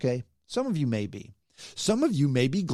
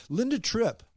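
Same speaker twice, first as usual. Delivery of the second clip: ordinary delivery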